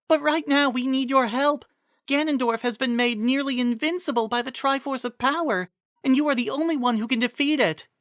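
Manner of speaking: put-on a young noblewoman shrugging off pain; weary Miranda. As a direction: Miranda reassuring Impa after her fall, weary. Miranda voiced in story.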